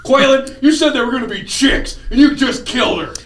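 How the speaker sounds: high-pitched villain whine; mock villain rant. Joking about the movie villain, in a high, hammed-up voice. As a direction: ham up the angry villain.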